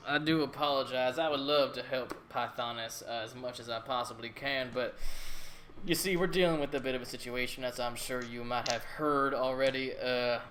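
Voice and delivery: put-on a pompous governor with a country accent. Governor voiced by GM.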